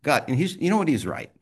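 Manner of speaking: ordinary delivery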